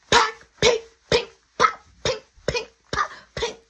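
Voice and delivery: high sharp popping noises; popping eggs. Retold or imitated. Imitating eggs popping as they burn on the stove. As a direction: mimic eggs crackling in a hot pan.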